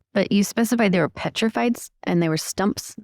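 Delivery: ordinary delivery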